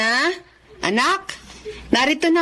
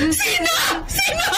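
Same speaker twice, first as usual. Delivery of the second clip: much higher crying shout; distraught demand; 9 semitones higher than usual. Acted. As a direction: demand the name, frantic. Mother demands who did it. (nagwawalang tanong ng ina)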